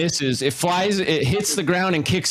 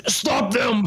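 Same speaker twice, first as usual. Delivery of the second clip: higher, pressing order; urgent command; 6 semitones higher than usual. Acted. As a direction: bark it at Edgar as the fight breaks out. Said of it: "he says".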